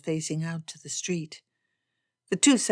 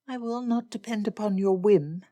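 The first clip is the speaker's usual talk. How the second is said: higher-pitched firm refusal; Elizabeth refusing; 4 semitones higher than usual. Acted. Elizabeth refuses to rely on Darcy, higher and firm. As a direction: refuse him in a raised woman's voice.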